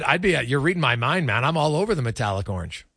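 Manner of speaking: usual speaking voice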